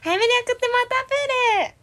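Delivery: high-pitched Tanchan impression; imitating Tanchan. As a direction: squeak it out like Tanchan. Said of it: "真似した、最後".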